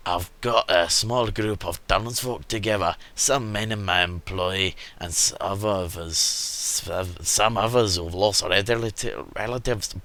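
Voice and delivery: lightly voiced Scottish merchant; a hint of a Scottish storyteller; put-on Scottish accent. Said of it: "He's now Scottish".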